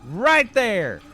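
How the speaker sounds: high, loud, drawn-out protest; indignant ranting crank. Acted. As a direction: cry out like a persecuted street preacher. Plays ranting street crank.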